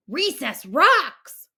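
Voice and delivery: loud, slow shark bellow. A narrator's reading. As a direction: bellow it out like a happy, rowdy shark. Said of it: "bellowed Clark the shark".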